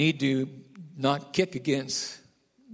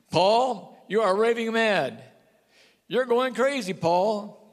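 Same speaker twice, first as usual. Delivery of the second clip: scornful governor; 6 semitones higher, 3 dB louder, slower than usual. Acted. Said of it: "One translation says".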